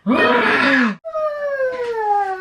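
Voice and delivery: sharp high gasp with a cry; startled gasp. Real, not acted. Mother gasping as her toddler moves.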